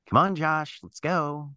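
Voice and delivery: game master playing a girl, voice lifted high; girl's voice. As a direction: pitch it up high to urge a friend along.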